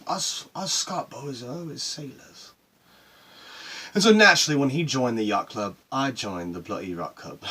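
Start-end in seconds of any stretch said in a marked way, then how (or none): 0.1-2.5 slow, higher-pitched imitation of father; mock-grand father voice, unhurried; dad's saying. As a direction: draw out dad's family motto. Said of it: "He'd always say that".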